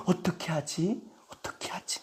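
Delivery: acted worry, sinking to a whisper; very soft, helpless self-talk; helpless muttering. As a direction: fret under your breath, fading away. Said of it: "입에서 하는 말이".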